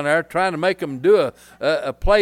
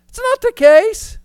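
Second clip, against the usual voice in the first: much higher and louder, a sudden shout; raised voice; 10 dB louder than usual. Shown as a demonstration. Preacher showing the congregation a raised voice, much higher and louder. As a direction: raise the voice high and loud. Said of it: "you can raise your voice".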